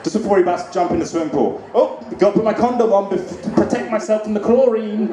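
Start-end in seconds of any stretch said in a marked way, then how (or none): 1.8-5.1 a touch higher, matter-of-fact mock voice; silly mask guy. Mask guy at the pool, mock earnest. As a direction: play him as dead serious. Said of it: "can you imagine that guy".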